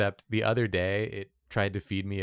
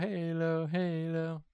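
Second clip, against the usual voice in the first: higher sing-song Halo, Halo; 9 semitones higher, slower than usual. Shown as a demonstration. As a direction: chant Halo up high. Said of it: "hello in an Australian accent".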